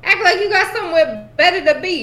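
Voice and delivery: high, loud scolding; exasperated teasing. Real, not acted. Scolding co-players for rushing.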